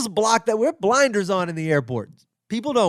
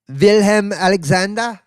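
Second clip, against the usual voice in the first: put-on German accent on the name; 3 semitones higher, 3 dB louder than usual. Comic naming the Dutch king, in a German accent. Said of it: "I gave him a German accent".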